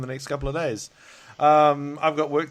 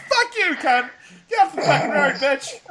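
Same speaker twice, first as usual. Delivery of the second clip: hammed-up screaming at other drivers; playful fake rant at a driver from the car; mock road rage; 12 semitones higher, 5 dB louder than usual. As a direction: pretend road-rage yell, much higher and louder. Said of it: "road rage central".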